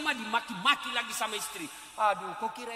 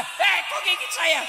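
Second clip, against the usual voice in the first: slow, high, surprised protest at being bitten; mock-hurt cry of a nursing mother; startled complaint; 6 semitones higher, 7 dB louder than usual. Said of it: "Ndak ada". (ibu kaget digigit, protes pelan dan tinggi)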